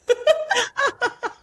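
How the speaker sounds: embarrassed giggle after a confession; laughing at herself; bursting into a giggle; real laugh. Real, not acted.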